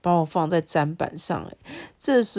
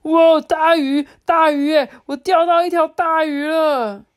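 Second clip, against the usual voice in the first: 高声狂喊，又慢又拖长; 钓到大鱼的狂喜呼喊; 兴奋大叫; 10 semitones higher, 8 dB louder than usual. A narrator's reading. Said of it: "我听到他大叫说". (渔夫兴奋地高声大叫)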